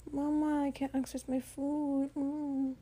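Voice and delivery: high, meowy mock whine; protesting cat. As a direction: whine like a cat complaining to its owner. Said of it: "meowing and protesting and being like".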